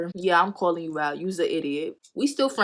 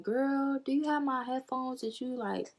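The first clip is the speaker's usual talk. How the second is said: higher, softer mock-sweet friend voice; sweetly imitating the friend asking for headphones; nice sweet ask; 7 semitones higher, 7 dB quieter than usual. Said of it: "she asked me in a nice way".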